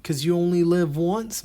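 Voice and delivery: high, loud mock party-bro voice; mock YOLO bro. A stand-up mocks a reckless bro, high and loud. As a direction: ham up a reckless bro shouting his motto.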